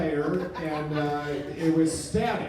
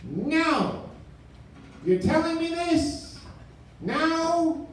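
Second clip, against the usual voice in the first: high, incredulous protest; mock outrage; 12 semitones higher, much slower than usual. Caller learns he has no TV, high incredulous outrage.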